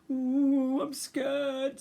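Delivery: high, frightened little voice; scared cub. Acted.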